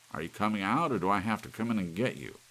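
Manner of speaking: loud, high menacing shout; man threatening to smash the clock; angry threat. A narrator's reading. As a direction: dare it to come out.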